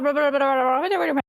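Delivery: imitating a super-fast mumbler; mumbled fast-talk. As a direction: rattle off garbled mock gibberish. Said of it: "He's mumbling and he's also, like, speaking super fast".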